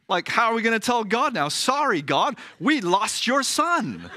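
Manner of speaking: a worried father's comic panic, half laughing; mock panic. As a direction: play Joseph dreading telling God, hammed up. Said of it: "And Joseph says".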